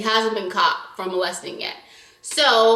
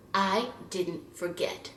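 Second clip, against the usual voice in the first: quiet grudge; 2 semitones lower, 9 dB quieter, slower than usual. Real, not acted. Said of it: "grudging statement".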